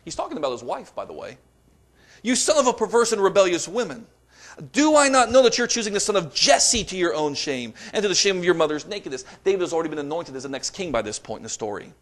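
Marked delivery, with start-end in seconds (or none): 2.2-9.2 higher and louder, scolding fury; angry outburst. Acted. Saul unloading on his son Jonathan, higher and louder. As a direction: hurl it at the son like a raging king. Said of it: "Saul's anger burned against Jonathan".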